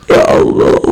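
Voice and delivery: whispered, teary "Ya Allah" behind a covered mouth; mock sob. Imitating a crying sister who covers her mouth, whispered sob. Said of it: "kalau ahwat nangis".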